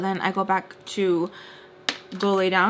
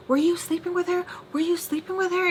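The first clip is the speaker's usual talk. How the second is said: high-pitched mock interrogation; retold loud accusation; 9 semitones higher than usual. Angry friend accusing Mister Peach, retold in high-pitched outrage. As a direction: imitate her pressing the accusation, pitch way up. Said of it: "She starts talking really loud".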